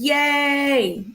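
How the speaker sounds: drawn-out high yay; performed girlish excitement; mock cheering. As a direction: let out a high, gleeful cheer. Reads girlfriend's cheering text aloud.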